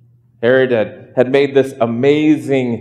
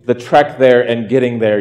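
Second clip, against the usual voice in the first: normal talking voice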